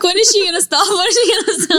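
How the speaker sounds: high, fast laughing voice; laughing self-quote. Retold or imitated. Laughing at her friend's long praise. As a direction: giggle it out, teasing. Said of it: "Yeah, I'm like".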